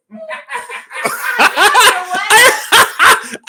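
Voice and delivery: high, loud cackling burst; real high-pitched laughing fit; helpless laughter. Real, not acted. Cracking up at what he almost said, helpless laughter. Nearly said something wrong.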